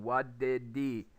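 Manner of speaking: slow "wha-did-dee", the "h" of "he" gone; slowed demo of linked "what did he"; reduced pronunciation. Shown as a demonstration. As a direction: link "did" into "he" without the "h". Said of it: "that would actually be the pronunciation".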